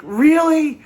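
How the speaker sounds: loud, very high questioning yell; imitated mock surprise. Singer questions the audience's answer, mock-surprised high shout. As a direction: throw it back at the crowd, high and incredulous. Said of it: "And he's like".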